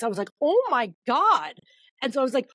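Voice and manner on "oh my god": slow, high, drawn-out thrill; retold awe. Author hearing meetings are coming, awestruck. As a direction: stretch it out in mock-overwhelmed amazement. Reacting to promised publisher meetings.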